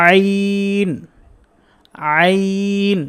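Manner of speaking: আইন from mid-throat, drawn out and held level; model pronunciation. Shown as a demonstration. Teacher leading students to repeat along, model আইন. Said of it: "আমার সাথে সাথে বলার চেষ্টা করেন".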